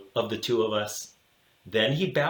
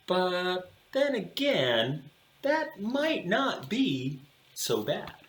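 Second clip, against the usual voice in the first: higher, slow and soft afterthought; wistful musing; 7 semitones higher, 4 dB quieter, slower than usual. A narrator's reading. Narrator musing to himself on the ride home, soft and slow. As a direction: smile at the thought, slowly.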